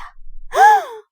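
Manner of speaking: sharp, high gasp; sudden loud intake of breath; shocked gasp. Acted. Shock at the bad news.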